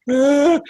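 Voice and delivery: a high, loud mocking "uh" of a sob. Mocking crying voters, a hammed-up sob. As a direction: imitate upset voters bawling. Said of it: "uh, crying".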